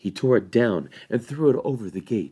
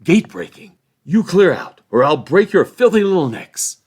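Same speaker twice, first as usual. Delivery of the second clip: loud, high bullying roar; furious threat; 10 semitones higher, 13 dB louder than usual. A narrator's reading. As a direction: threaten them with violence. Ruffian threatening.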